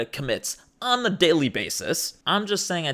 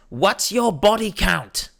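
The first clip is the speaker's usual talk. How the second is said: put-on slow, higher British lad accent; 5 semitones higher, slower than usual. Club guy asks the girl, slow British lad accent. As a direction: lean in and ask it slowly, British lad. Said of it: "And then he goes".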